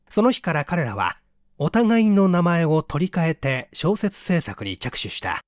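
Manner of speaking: ordinary delivery, nothing marked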